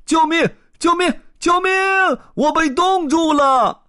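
high, loud mock-desperate cries; crying for help. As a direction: shout for help as if frozen stuck. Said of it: "传来他的喊声".

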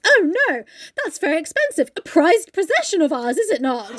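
lightly acted "oh no" of a fussy master; mock dismay. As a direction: put on concern over a treasure. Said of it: "And he goes".

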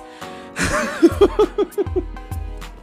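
high, breathless giggle; airy laugh. Real, not acted. Host amused at straining for whistle notes, laughing.

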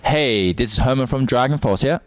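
loud, bright show intro; TV presenter voice. Acted. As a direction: announce it like a presenter.

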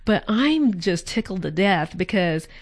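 normal talking voice

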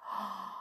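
soft gasp of waking. A narrator's reading. Alice waking beside her sister, soft gasp. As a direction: draw a quick, soft breath in.

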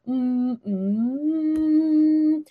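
sliding hum that rises in pitch; resonance hum. Shown as a demonstration. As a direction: slide the hum up until the lips buzz. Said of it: "put your fingertips up and you're like".

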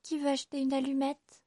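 put-on a little match girl calling her wares. A narrator's reading. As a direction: cry it out to passers-by, hoping someone buys. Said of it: "criait-elle".

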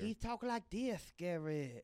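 high, slow lisping Southern drawl; Dusty Rhodes voice. Retold or imitated. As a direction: slur it out like an old-school wrestler with a lisp. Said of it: "He had a lisp when he talked".

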